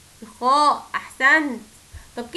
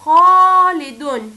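خالدون much louder and very slow, syllables drawn out; slow loud reading; 2 semitones higher, 11 dB louder, much slower than usual. Shown as a demonstration. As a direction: stretch out خالدون loudly. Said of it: "كلمة".

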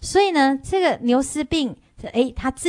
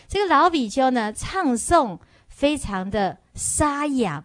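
ordinary talk

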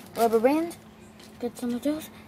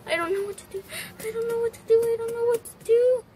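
higher and louder each time, hammed-up; building mock panic; 11 semitones higher, 5 dB louder, faster than usual. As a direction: spiral into pretend helplessness. Pretend panic over toy spider.

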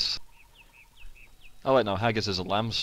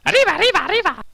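very high, loud cartoon Mexican shout; 11 dB louder, much faster than usual. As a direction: squeal it like a cartoon Mexican mouse. Echoing the Mexican accent joke.

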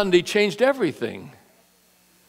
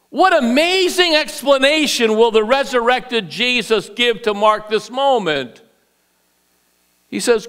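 usual speaking voice

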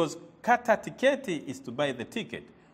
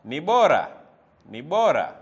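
loud drilled phrase; 4 semitones lower, 7 dB louder than usual. Shown as a demonstration. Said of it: "It is better".

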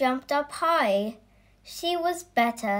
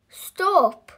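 much higher, louder stop; commanding shout; 8 semitones higher, 5 dB louder than usual. Acted. The vet stopping the wild animals, commanding shout. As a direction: halt the room with one cry.

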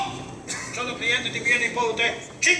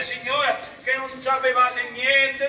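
ordinary talk

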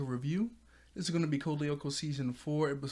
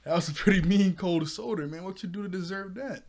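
higher, louder mock teasing; teasing friend; 5 semitones higher, 7 dB louder than usual. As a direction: rib him about the snub. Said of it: "And then I was like".

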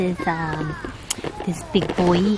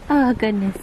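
ordinary talk

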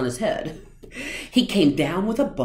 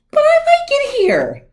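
very high squeaky cat; 11 dB louder than usual. A narrator's reading. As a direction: pipe it up high and cheeky. Cat answers fish, squeaky.

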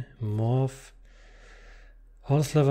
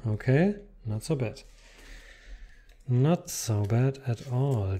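ordinary talk

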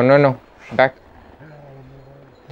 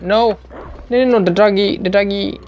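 tag distressed; 6 semitones higher than usual. Pretend. Dog threatened in the game.

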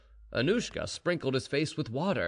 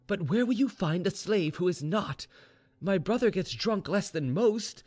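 higher-pitched reasoning voice; earnest maid, persuasive; 7 semitones higher than usual. Acted. Maid defends her brother to mistress, earnest and persuasive. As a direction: press the argument earnestly.

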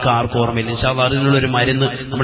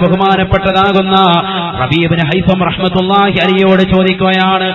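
full-force preaching; 5 semitones higher, 7 dB louder than usual. A narrator's reading. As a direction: proclaim it to the congregation, high and loud. Preacher swells into chanted narration. (ഉച്ചത്തിൽ നീട്ടിപ്പറയുന്ന പ്രഭാഷണ ശൈലി)

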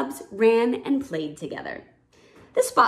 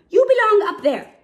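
usual speaking voice

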